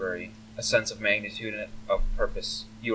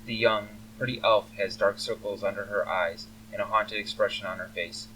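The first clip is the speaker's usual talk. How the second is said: normal talking voice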